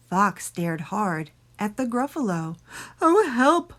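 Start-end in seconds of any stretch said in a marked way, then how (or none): 3.0-3.7 high, loud, barked cry; barking fox. A narrator's reading. Fox sees the Gruffalo, high loud bark. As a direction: yelp it out like a startled fox. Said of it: "barked Fox".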